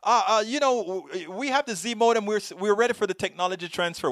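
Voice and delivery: high, hesitant fake-friendly voice; imitating sheepish caller. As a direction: stammer it like you need a favor. Caller sheepishly admits needing him.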